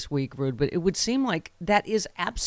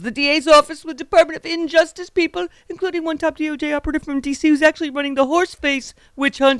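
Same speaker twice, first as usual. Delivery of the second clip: mocking high-pitched politician's voice; high, put-on caricature of a ranting politician; Trump; 10 semitones higher, 4 dB louder than usual. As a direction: read the post in a squeaky, much higher mock voice. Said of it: "real voice is actually that high pitched".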